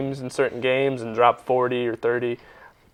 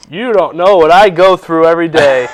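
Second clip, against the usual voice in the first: louder, huffy mock outrage; mock indignant; 2 semitones higher, 7 dB louder than usual. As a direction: play the aggrieved martyr. Mock self-pity rant.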